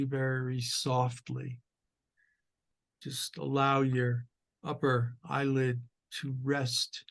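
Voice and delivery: measured meditation guide with long pauses; guided meditation. Acted. Teacher leading sitters into awareness of the breath, guided meditation voice. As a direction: guide listeners gently through posture and breath, unhurried.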